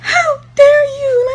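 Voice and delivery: high, slow, tearful theatrical aunt; hammed-up crying aunt; dramatic aunt. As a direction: wail it like a melodramatic aunt. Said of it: "very dramatic".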